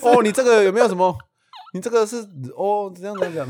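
put-on high, loud gushing old-man voice; old man praising the rider, hammed up high; imitating the old man. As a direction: praise like an impressed old uncle. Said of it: "口气就是这样子".

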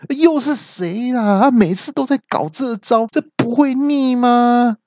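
高声缓慢的恼怒抱怨; 被打断而气急败坏地叫; 气得大叫. A narrator's reading. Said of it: "气得那边大叫说". (高声气恼的抱怨)

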